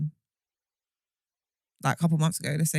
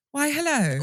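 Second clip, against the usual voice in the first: high-pitched mock-British greeting; exaggerated British; 9 semitones higher, 3 dB louder than usual. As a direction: put on a very British hello. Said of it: "I sound more British".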